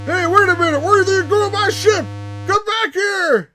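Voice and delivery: loud, high-pitched shout; hollering after someone. Acted. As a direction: yell after a ship that is leaving. Acted shout after departing ship.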